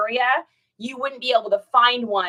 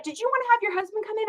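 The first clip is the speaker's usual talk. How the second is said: lightly voiced realtor, higher and quick; a hint of a chirpy realtor, higher, fast; 11 semitones higher, 5 dB quieter, faster than usual. Realtor asking about her husband, lightly higher and quick. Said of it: "She's like".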